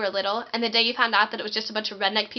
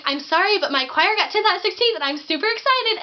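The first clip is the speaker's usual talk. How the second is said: higher, louder excited gush; bursting with pride while apologizing; much higher, louder giddy explanation; giddy apology; 8 semitones higher, 4 dB louder than usual. Retold or imitated. Apologetic but thrilled.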